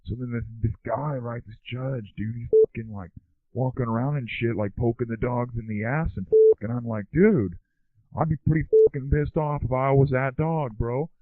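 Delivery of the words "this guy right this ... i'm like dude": usual speaking voice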